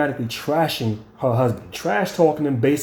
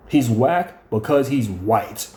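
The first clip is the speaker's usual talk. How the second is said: slow repeat; 4 semitones lower, much slower than usual. Retold or imitated. Repeating the wife's jab about her husband, slowly. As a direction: spell it out slowly, word by word.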